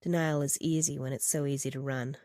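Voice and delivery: ordinary delivery, nothing marked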